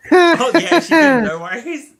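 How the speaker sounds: mock high squeal.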